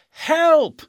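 shrill high shriek of terror; a witch screaming for help; witch's scream. A narrator's reading. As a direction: scream it at the top of the lungs. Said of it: "screamed the witch".

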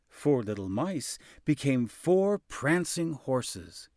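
usual speaking voice

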